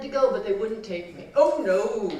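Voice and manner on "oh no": loud, drawn 'oh no' from a scoffing uncle; discouraging uncle. Acted. Uncle turning down his eager little niece, scoffing. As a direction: voice an uncle refusing, firmly. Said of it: "my uncle said".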